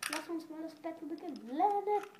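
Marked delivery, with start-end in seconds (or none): 1.5-2.1 launch shout. Real, not acted. Launch call at battle start.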